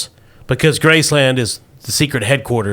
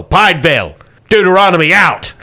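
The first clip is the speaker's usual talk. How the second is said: loud, raised, emphatic sign-off; commanding sign-off; 6 semitones higher, 6 dB louder than usual. Acted. Boss orders agents to Idaho and hangs up, commanding. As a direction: bark it to end the call. Boss signs off, slams phone.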